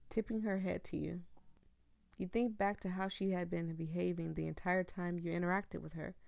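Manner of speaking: ordinary talk, nothing marked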